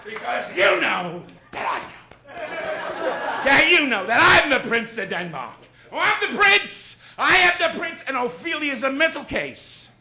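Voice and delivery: Jackie Gleason doing Shakespeare. Acted. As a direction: boom it out like a big-mouthed TV comic playing Hamlet. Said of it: "Here is Jackie Gleason doing Shakespeare".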